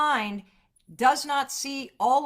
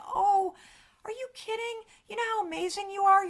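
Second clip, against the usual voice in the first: much higher, bubbly and fast; gushing friend; 11 semitones higher than usual. Retold or imitated. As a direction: play the over-eager cheerleader friend. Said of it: "your friend's like".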